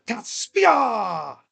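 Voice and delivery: drawn-out mock war cry, then a high "ha!"; hammed-up warrior shout in a Japanese accent; samurai battle yell. Shown as a demonstration. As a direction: yell the name like a lunatic samurai. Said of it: "you just yell with the top of your lungs".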